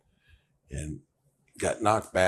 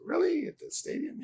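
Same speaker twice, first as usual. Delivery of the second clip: much higher, breathy-free squeak of disbelief; mock surprise; 5 dB quieter than usual. Footballer hears about a movie shoot, surprised. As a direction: act amazed, way up high. His surprised reply retold.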